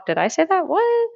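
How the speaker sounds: high-pitched feigned surprise; mock innocence. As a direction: play innocent, voice shooting way up. Feigns innocence after leaking test hint.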